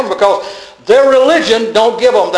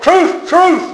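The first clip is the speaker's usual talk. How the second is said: truth, truth much higher; high ringing proclamation; proclaimed; 12 semitones higher than usual. Acted. Voicing Jesus' words again.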